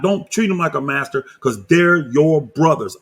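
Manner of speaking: ordinary talk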